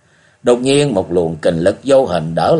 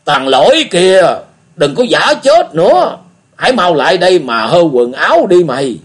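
put-on old beggar calling out; 8 semitones higher, 5 dB louder than usual. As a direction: bark at the boy to come to the fire. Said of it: "rồi kêu lên".

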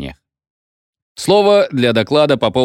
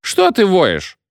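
higher tipsy voice; a drunk doctor teasing, higher; drunk feldsher; 9 semitones higher, slower than usual. A narrator's reading. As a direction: needle the howling chairman. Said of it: "спросил фельдшер у председателя".

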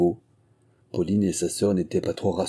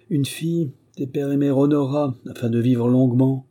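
high, raised-voice sermonising; mock-solemn scolding, pitched up; admonishing recitation; 5 semitones higher, 4 dB louder than usual. A narrator's reading. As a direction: recite it like a commandment to a naughty child. Innkeeper recites commandment as reproof.

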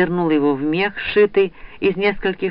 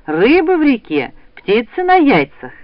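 higher, louder rousing cry; calling out; 6 semitones higher, 4 dB louder than usual. A narrator's reading. Mother rousing her son for the hunt, calling out. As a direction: call it out brightly to get someone up. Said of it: "крикнула она".

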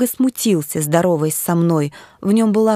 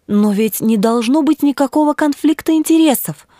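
higher-pitched exclamation of protest; exclaiming; 6 semitones higher than usual. A narrator's reading. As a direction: exclaim it, pitch climbing as you object. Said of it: "воскликнула я".

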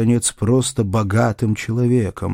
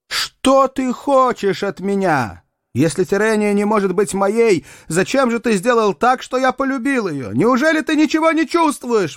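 high-pitched anguished plea to heaven; despairing cry; 11 semitones higher than usual. A narrator's reading. Rejected suitor demanding answers from God at night, crying out in despair. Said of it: "кричал он".

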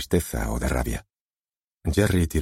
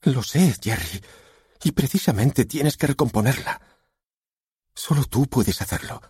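high-pitched, insistent wife; earnest wife, pitched up; urging wife; 9 semitones higher than usual. A narrator's reading. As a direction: urge a husband on in a high voice. Wife urges husband in story.

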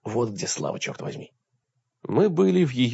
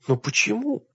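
high-pitched heartbroken question; 11 semitones higher than usual. A narrator's reading. Woman questioning her lover in a story, heartbroken. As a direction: ask it as if your heart just broke. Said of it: "таким голосом, будто ей разбили сердце".

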